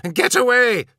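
loud, high urgent shout. A narrator's reading. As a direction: yell at him to move. Urgent warning in danger.

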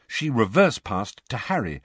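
usual speaking voice

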